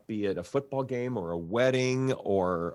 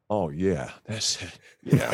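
mock moaning; 3 semitones lower than usual. Shown as a demonstration. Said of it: "we'll do".

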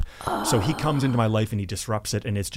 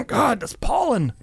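choked-up cover story; 11 semitones higher than usual. Retold or imitated. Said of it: "I'm not crying".

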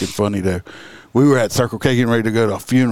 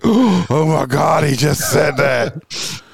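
slow, drawn-out scandalised outcry; mock shocked gasp; 2 semitones higher, much slower than usual. Bystanders in the hall reacting to the joke, slow mock-shocked outcry. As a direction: act out a crowd gasping in disbelief.

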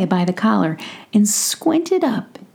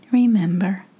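usual speaking voice

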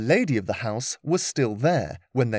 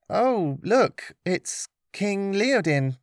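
mock surprise; 8 semitones higher, much slower than usual. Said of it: "I could say".

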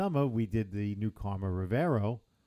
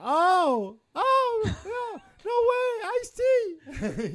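much higher and louder, laughing in disbelief; starstruck fan; 7 dB louder, much slower than usual. Retold or imitated. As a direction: squeal like a fan who just spotted a celebrity. Cop recognizes [name], starstruck.

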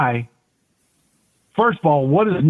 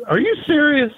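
a light imitation of a Texas drawl, pitched high in disbelief; mock Texas drawl, incredulous; 12 semitones higher than usual. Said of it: "his southern drawl, Texas, dialect".

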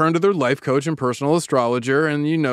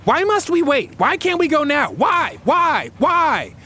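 barking demand; 10 semitones higher, slower than usual. Acted. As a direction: bark it like an angry little girl. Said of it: "She barks back".

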